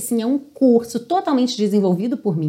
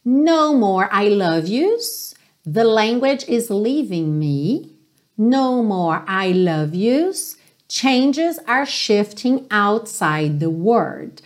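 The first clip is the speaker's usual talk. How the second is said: lyric read very slowly; much slower than usual. Shown as a demonstration. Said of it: "Aqui não tem muito mistério". (letra lida devagar)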